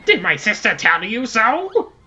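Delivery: lightly voiced rhyming witch; a hint of teasing Gruntilda; Grunty witch voice. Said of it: "I'll stop over there, Grunty".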